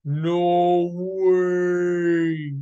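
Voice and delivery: loud, drawn-out astonishment; long, loud, awestruck cry; amazed exclamation. A narrator's reading. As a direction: stretch it out in booming disbelief. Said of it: "and he exclaimed".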